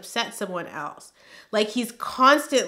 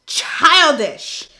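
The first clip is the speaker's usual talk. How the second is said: loud outburst; 7 semitones higher, 9 dB louder than usual. Real, not acted. Reading her old note, she shouts in scorn. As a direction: yell the word out high and loud. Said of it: "That's what it says here".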